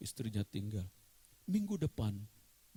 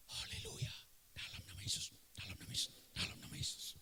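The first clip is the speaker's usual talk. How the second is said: fervent whisper, no voice; wife whispering prayers on the back of the motorbike; 7 semitones lower, 12 dB quieter than usual. Acted. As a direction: pray under your breath, urgently. Wife praying behind him on motorbike.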